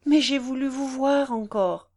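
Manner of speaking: high, loud tearful plea; acted anguish, close to sobs; sobbing. A narrator's reading. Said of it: "Elle sanglotait".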